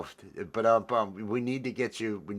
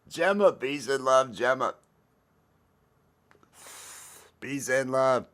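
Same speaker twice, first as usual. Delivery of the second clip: loud, raised shout-out greeting; louder, higher friendly hail; calling out; 5 semitones higher, 4 dB louder than usual. Real, not acted. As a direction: call her name out to the room. Shout-out to a chat viewer.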